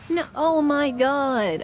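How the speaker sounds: slow, loud shocked cry; drawn-out dismayed no. Real, not acted. Shocked reaction to explosion.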